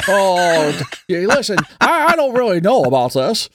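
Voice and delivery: Jimmy Stewart impression. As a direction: hem and haw like Jimmy Stewart. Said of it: "a Jimmy Stewart impression".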